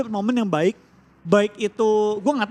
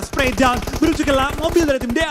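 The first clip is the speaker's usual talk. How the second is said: excited call; 5 semitones higher than usual. Real, not acted. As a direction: ride the action. Caster calling live gunfight.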